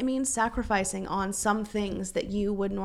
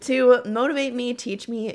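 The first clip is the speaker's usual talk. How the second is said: louder, emotional restart holding back tears; choked up; 2 semitones higher, 7 dB louder than usual. Real, not acted. Rereading a listener's message, she reads on choked up. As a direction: push through the words close to crying. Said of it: "I'm trying not to cry".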